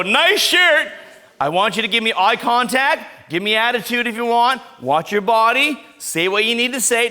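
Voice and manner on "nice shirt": mock sarcastic bully. Shown as a demonstration. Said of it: "All I'm gonna do is go".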